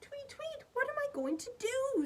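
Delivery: high, squeaky worried bird voice. Acted. Lost Bird worrying to herself, high and squeaky. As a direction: fret like a lost little bird. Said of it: "said Bird".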